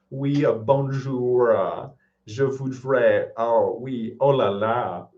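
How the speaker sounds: put-on slow, struggling American-accented French. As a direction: imitate an American labouring through French. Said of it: "it is the American accent in French".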